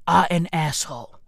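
ordinary talk

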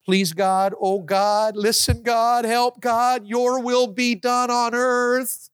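rising, building plea to God; fervent prayer. Shown as a demonstration. Preacher showing the prayer Jesus taught, building urgency. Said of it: "you ought to say".

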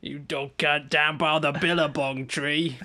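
slow, stretched Aussie drawl; exaggerated Australian impression; mock Australian accent. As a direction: put on a broad Australian twang. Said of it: "Aussie, Aussie words".

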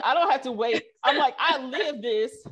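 high, loud, laughing insistence; excited retort. Retold or imitated. Founder answering Google that she lives this, in an excited retort. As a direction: proudly declare you live it.

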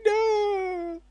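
long, high-pitched "no" of despair; mock despairing wail. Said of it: "I feel I'm gonna cry".

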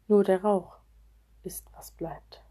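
a vanishing whisper. Acted. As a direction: let it dissolve into a whisper. Smoke fading away. (verhauchend geflüstert)

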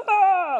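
sudden high, loud cartoon yell; hammed-up high yelp of a singing rock; mock rock shout. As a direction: belt out a goofy shouting-brick cry. Rocks crying out in worship.

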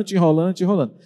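ordinary talk

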